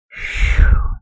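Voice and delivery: breathy phew of relief; relieved sigh. Acted. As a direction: blow out all the tension. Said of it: "heaved a massive sigh of relief".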